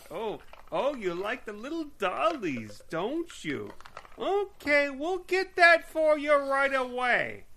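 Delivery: high sing-song shop lady, hammed up; patronizing, very high mock-sweet voice; mock-cooing saleslady. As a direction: coo at a customer as if at a small child. Mocking saleslady voice about dollies.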